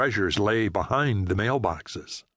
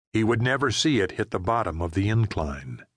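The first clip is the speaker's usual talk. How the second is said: ordinary delivery, nothing marked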